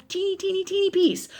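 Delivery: very high, small squeak; tiny voice. Acted. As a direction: shrink the voice up high for something tiny. Miming tininess with the voice.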